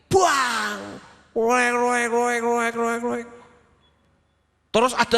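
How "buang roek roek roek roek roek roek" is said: banging pan noises, clanging and hollow; higher mock clatter of a thrown pan; imitated pan clatter. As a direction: mimic a pot being slammed down again and again. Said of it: "suara panci yang dibanting".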